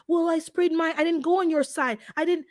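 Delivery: mock neighbour insisting she didn't spray; whiny defensive neighbour. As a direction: play the neighbour denying blame. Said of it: "they're like".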